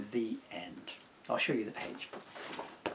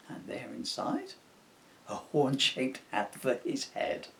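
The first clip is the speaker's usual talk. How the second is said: ordinary delivery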